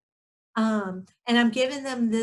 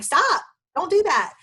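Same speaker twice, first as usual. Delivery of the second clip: higher, louder sharp "Stop, don't do that"; exasperated snap; 9 semitones higher, 4 dB louder than usual. Retold or imitated. Mother to her wild kids, a sharp higher, louder scold. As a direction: bark it like a fed-up parent cutting off wild kids. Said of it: "And I'm like".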